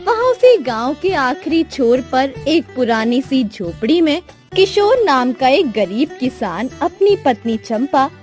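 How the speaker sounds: usual speaking voice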